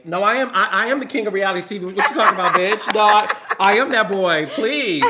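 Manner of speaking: hammed-up indignant boasting; bossed up. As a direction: boss up and proclaim it like royalty. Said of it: "I had to boss up sometime".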